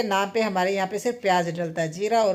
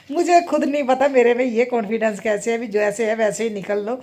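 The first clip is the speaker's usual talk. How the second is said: normal talking voice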